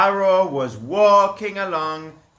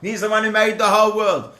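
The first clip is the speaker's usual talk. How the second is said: ordinary delivery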